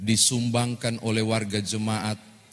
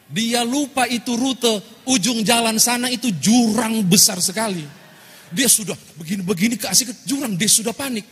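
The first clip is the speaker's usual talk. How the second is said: louder and higher as the danger grows; rising alarmed telling; 11 semitones higher, 4 dB louder than usual. A narrator's reading. Preacher tells the congregation of the ravine ahead, alarmed and louder. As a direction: build the panic toward the cliff. Narrating rush toward the ravine.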